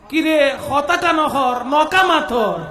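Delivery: a Chittagonian retort, slow and half laughing; Chittagonian dialect. Shown as a demonstration. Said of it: "চট্টগ্রামের আঞ্চলিক ভাষা কি হবে". (চট্টগ্রামের আঞ্চলিক টানে)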